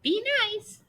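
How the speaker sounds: very high parrot echo; high squawking parrot repeats "Be nice". Acted. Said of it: "said the parrot".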